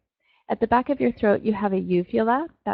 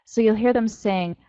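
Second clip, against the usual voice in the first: ordinary talk, nothing marked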